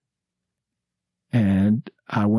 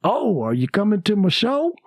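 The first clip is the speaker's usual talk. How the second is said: Cosby invite; 8 semitones higher than usual. Retold or imitated. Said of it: "And he says".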